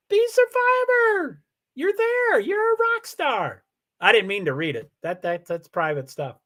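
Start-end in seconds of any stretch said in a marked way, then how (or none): 0.1-3.6 very high, drawn-out cheer; excited shout-out. Real, not acted. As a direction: gush over her like a fan.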